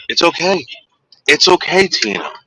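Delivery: high sing-song comfort for a dog. Real, not acted. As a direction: coo to calm a frightened dog. Soothing scared dog.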